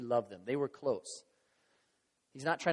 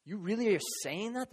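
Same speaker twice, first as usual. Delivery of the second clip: acted incredulous disciple, higher in pitch; mock disbelief; 5 semitones higher than usual. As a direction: play a stunned objection to Jesus. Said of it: "from those outside and those inside".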